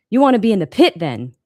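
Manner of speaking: perky Louisiana drawl. A narrator's reading. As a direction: lightly voice a chipper Louisiana teacher. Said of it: "in his perky Louisiana drawl".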